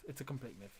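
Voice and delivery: trailing off in resignation; fading. Real, not acted. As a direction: let it fall away to almost nothing. Fading repeat of the same remark.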